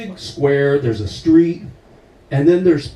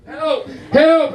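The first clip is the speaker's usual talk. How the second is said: mock cry for help; 11 semitones higher than usual. Beaten up, crying for help.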